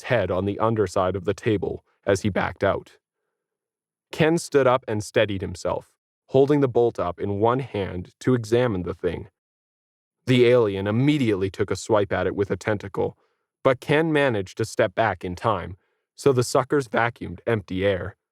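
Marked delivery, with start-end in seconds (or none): none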